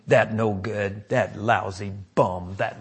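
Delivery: low, hushed devilish muttering; devil's whisper. Acted. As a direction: mutter it softly, like the devil in a sleeper's ear. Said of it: "the devil's whispering in your ear".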